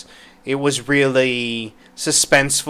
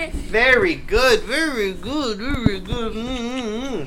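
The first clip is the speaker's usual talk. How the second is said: sing-song praise; 7 semitones higher, slower than usual. Real, not acted. Praising a toddler.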